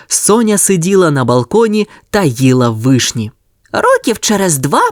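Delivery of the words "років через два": higher dreamy puppy voice; little dog musing. A narrator's reading. Sonya dreams of a cherry orchard, high voice. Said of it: "подумала собачка Соня".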